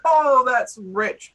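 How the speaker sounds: slow, loud chuckling delight; amused guard; laughing approval. Acted. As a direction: savour the joke, still laughing. Guard enjoying the joke.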